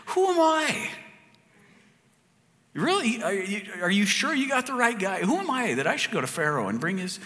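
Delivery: high, pleading Moses voice, disbelieving; a hint of a whiny, unsure Moses; doubting Moses. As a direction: play Moses protesting he's the wrong guy. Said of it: "he says".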